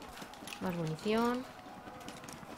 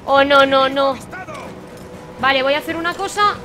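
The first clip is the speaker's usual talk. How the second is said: high, loud fired-up yelling; loud defiant shouting; 4 semitones higher, 10 dB louder than usual. Real, not acted. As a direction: shout it as you go all in. Fighting back in desperation. (gritos desafiantes)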